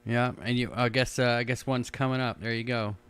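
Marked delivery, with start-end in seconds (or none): none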